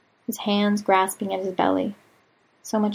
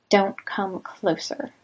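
ordinary delivery, nothing marked

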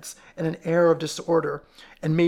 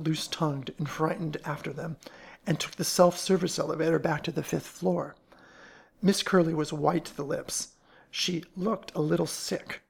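usual speaking voice

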